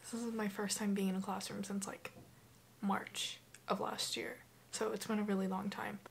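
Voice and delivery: soft early morning whisper; hushed so nobody wakes. Real, not acted.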